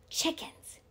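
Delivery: soft whisper on the breath. A narrator's reading. Boy answering friend while creeping, breathed. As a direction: let it out as a hushed breath. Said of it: "he breathed".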